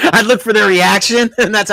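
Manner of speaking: high loud laugh spilling into words; laughing hard. Real, not acted. Telling the host about the volcano joke, laughing loudly. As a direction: laugh through the line.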